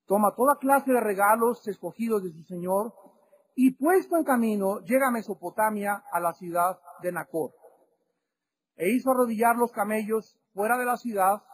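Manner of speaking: ordinary delivery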